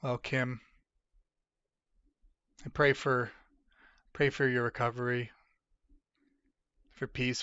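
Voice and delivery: quiet, sincere prayer; soft prayer. Real, not acted. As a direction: pray softly for her. Prays for viewer Kim.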